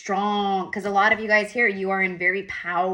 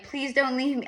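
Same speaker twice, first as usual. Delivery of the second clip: put-on childlike pleading; 6 semitones higher than usual. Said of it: "As a kid, I keep getting that".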